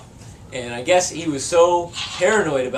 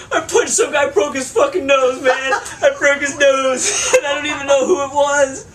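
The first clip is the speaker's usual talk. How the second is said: put-on high, louder blubbering drunk, breaking into laughs; crying drunk friend; 10 semitones higher, 4 dB louder than usual. Drunk friend confessing to roommate, high mock-crying with laughter. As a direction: wail it like a sobbing drunk. [name] voiced, teller laughing.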